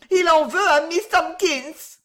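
high, loud panicked outcry; alarmed cry. A narrator's reading. Women panic at the intruder.

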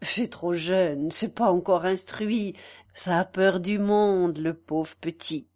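low, slow and gentle; kind murmur. Acted. Mother excusing her frightened boy, murmuring kindly. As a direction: soothe and excuse the shy child. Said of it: "qui murmura avec bonté".

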